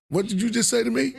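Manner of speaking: high, loud mock indignation; mock outrage. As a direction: play being offended, hammed up. Mock outrage at own line.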